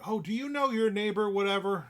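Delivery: high, loud mock sales opener; imitated salesman. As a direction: mimic a chirpy door-to-door caller. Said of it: "he's like".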